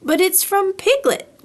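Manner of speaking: put-on boy crying out in surprise over a message; cried out. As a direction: exclaim it as the news sinks in. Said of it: "cried Christopher Robin".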